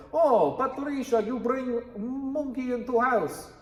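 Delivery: high, loud, slow hammed-up Russian; Russian accent. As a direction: exclaim in a thick stage Russian accent. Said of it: "I'm Russian".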